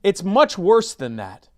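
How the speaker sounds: a hint of a prim prime minister; Justin Trudeau impression. Mocking the prime minister to Matt, a hint of Trudeau. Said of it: "my Justin Trudeau voice".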